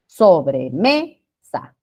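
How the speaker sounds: Sobremesa very slow, syllable by syllable, stress on ME; sílaba tónica marcada. Shown as a demonstration. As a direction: stretch out Sobremesa to show the stressed ME. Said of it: "Aquí está la sílaba tónica". (Sobremesa muy lenta, por sílabas, marcando ME)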